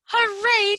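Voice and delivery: high, loud hooray; cheer. Acted. Frogalina's fans cheering her platform, high loud hooray. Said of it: "cheered Frogalina's fans".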